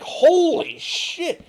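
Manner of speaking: a startled slow holy shit; shocked. Retold or imitated. Viewer jolted by a piercing ASMR relax, shocked. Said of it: "I'm like".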